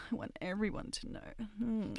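usual speaking voice

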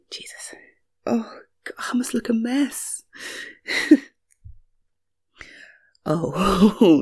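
normal talking voice

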